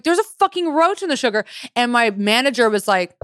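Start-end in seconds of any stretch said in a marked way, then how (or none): 0.0-1.4 high, indignant complaint; outraged customer. Retold or imitated. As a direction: squeal it in disgust.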